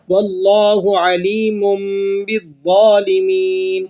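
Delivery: slow, sustained chant, علیم closing on a long hummed m; iqlab recitation. Shown as a demonstration. Teacher reciting the rule applied, iqlab recitation. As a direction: stretch it out with "mummm" in علیم before بالظالمین. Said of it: "میم کی آواز کے ساتھ پڑھنا ہوتا ہے".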